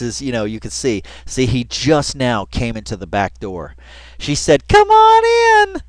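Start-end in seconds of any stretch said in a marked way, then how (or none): 4.7-5.8 very high, loud, drawn-out imitation; mock call-in. Woman calling a visitor in from the back door, mock high call. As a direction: sing out a slow, high mock invitation.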